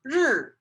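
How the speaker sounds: r held as a buzzing sound, tongue suspended behind the teeth; Chinese r sound. Shown as a demonstration. Teacher demonstrating the Chinese word for sun. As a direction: sound out the r slowly for the class. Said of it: "So this is pronounced".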